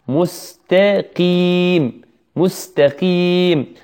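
مستقيم drawn out slowly with light م, س and ت; light, careful articulation. Shown as a demonstration. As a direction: stretch مستقيم slowly, keeping the first letters thin. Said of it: "يخطئ في الميم ويفخمها". (نطق مستقيم ببطء مع ترقيق الميم والسين والتاء)

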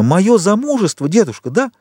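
high, eager questioning; sudden exclamation. A narrator's reading. Valentine guessing that her marriage is the reason, exclaiming. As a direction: exclaim the guess, pitched right up. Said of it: "воскликнула вдруг Валентина".

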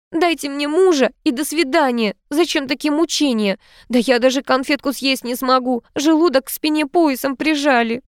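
high, fretful protest; annoyed. A narrator's reading. Niece protesting the torment of dressing up, annoyed. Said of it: "разозлилась Анита".

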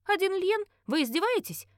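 high, incredulous retort; sharp high-pitched disbelief; caustic indignation. A narrator's reading. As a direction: throw the price back, affronted. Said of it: "Не удержалась от едкого комментария".

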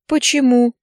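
bewildered, stung why; hurt. A narrator's reading. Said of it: "обиделась и не поняла".

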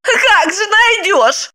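high, slow, contemptuous; spat out spitefully. Acted. Wife spits back at her husband, slow high scorn. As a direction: spit it at him. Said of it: "зло выплюнула".

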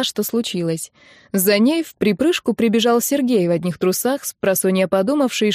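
ordinary delivery

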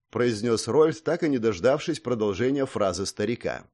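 ordinary delivery

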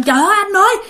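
high-pitched mock panicked calling out; acted cry for help, not a full shout; imitated alarmed cry. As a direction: call out to your brother in retold alarm, pitched high. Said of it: "mới la lên".

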